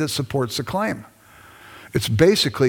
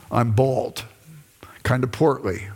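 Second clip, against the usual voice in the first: whispered aside; 3 semitones lower than usual. Real, not acted. Self-mocking aside to class.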